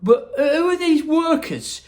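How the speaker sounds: put-on high, loud child's question; a greedy boy asking; boy's voice. Augustus voiced in story.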